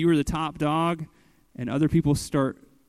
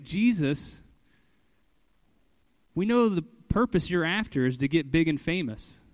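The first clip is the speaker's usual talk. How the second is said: ordinary talk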